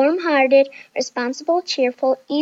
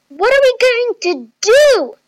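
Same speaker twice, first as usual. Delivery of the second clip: high, loud, drawn-out wail; high-pitched desperate shout; panicked yell; 11 semitones higher, 8 dB louder than usual. A narrator's reading. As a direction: yell it as loud as you can, slowly. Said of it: "Chloe yelled as loud as she could".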